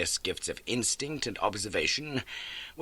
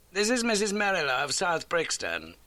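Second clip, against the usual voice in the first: lightly raised voice for Holmes introducing a guest; a crisp, brisk detective; 11 semitones higher than usual. A narrator's reading. Holmes introduces the landlady to Watson, brisk.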